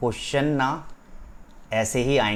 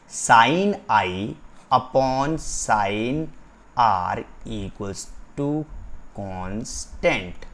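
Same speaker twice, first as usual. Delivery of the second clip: each term drawn out; slow dictation; much slower than usual. Shown as a demonstration. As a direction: read the formula out as it is written. Dictates formula while writing. (धीरे-धीरे सूत्र लिखवाते हुए)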